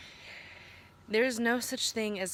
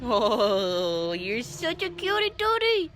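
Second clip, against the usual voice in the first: much higher, cooing pet voice; 4 semitones higher, 4 dB louder, slower than usual. Real, not acted. Baby talk to the cat.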